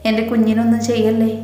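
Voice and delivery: usual speaking voice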